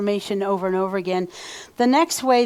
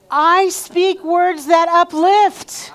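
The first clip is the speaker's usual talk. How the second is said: slow, much higher and louder proclamation; standing, full energy; 7 semitones higher, 6 dB louder, much slower than usual. Shown as a demonstration. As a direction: put your whole soul into every word. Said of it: "Put your energy into this".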